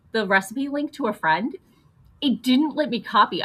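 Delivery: ordinary talk, nothing marked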